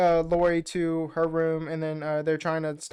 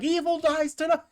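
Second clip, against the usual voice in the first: high, loud defiant battle cry; rallying cry; 11 semitones higher, 3 dB louder than usual. Retold or imitated. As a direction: hurl it back at the crowd as a vow. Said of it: "Tommy turns around and is like".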